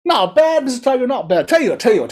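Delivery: very high, squeaky frog voice; high, smug little storybook frog; cheeky frog. A narrator's reading. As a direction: patronize the tiger, pipingly. Frog figure mocks the spit.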